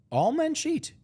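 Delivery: high-pitched, stunned question; incredulous. Retold or imitated. Friend questioning his claim about cheating, incredulous. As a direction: echo it back in high disbelief.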